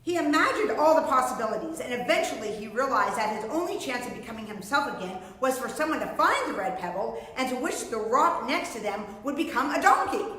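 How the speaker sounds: ordinary delivery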